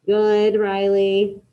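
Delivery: ordinary delivery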